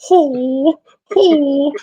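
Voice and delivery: very high mock karate cries; hammed-up kiai yelps; karate shouts. Opponent showing off karate moves, high mock kiai cries. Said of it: "He's like".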